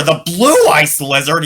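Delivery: loud, high whoop of joy; excited cry. Acted. Boy hears the dragon news, loud excited cry. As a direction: shout it out with delight. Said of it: "he cried out".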